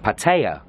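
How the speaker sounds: PATTAYA as pat-AY-uh, TAY in the middle; pronunciation two. Shown as a demonstration. Vlogger showing the vloggers' way, Pattaya as pat-AY-uh. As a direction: say Pattaya with a middle that rhymes with day. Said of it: "Number two".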